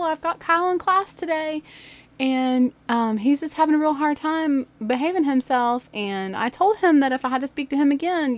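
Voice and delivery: high-pitched, careful phone voice; polite teacher call. Retold or imitated. Teacher reporting Kyle to his mom, politely high. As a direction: re-enact a tactful call to a parent.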